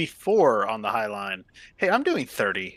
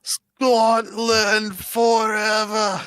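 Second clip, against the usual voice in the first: battle cry; 7 semitones higher, 4 dB louder, much slower than usual. Acted. Battle cry after Scotland the Brave.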